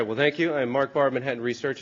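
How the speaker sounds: ordinary talk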